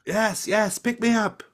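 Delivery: small goblin pleading to be carried; begging goblin. Acted. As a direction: beg like an eager little goblin child.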